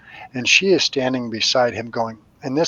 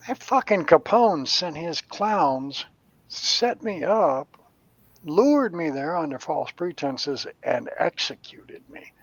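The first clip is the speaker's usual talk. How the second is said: bitter spirit; 5 semitones higher, slower than usual. Retold or imitated. Murdered man telling his grievance.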